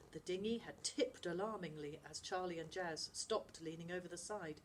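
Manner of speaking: ordinary delivery, nothing marked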